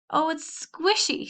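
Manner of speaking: high, slow sobbing wail. A narrator's reading. Annabel cries to her cousins in the hayloft, sobbing. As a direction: whimper it through tears. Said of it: "she sobbed".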